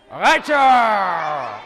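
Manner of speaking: loud shouted Raichu call; Raichu cry. Acted. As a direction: yell it like a Pokémon. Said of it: "Three, two, one".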